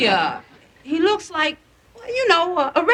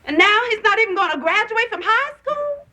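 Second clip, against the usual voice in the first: high, rising, incredulous complaint; exasperated mother; 10 semitones higher than usual. Acted. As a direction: climb in pitch with disbelief. Mother upset about son quitting.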